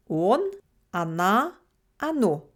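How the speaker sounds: on, она, оно said apart, each clearly; teacher spells out on, она, оно with pauses; Russian pronouns. Shown as a demonstration. Teacher shows students on, она, оно one by one. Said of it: "on les voit très bien quand on regarde les trois pronoms".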